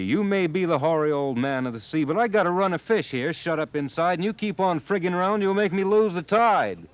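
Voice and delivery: put-on old fisherman building to a high, loud rant; rising anger. A narrator's reading. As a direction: climb into a rage toward the tide line.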